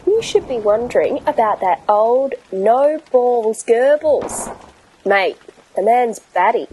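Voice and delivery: cheery Aussie bloke; matey Australian banter; Australian accent. Acted. Australian captain Davo sketch.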